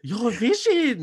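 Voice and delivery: high gushing compliment. Retold or imitated. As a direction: gush it like a fan. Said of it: "she's like".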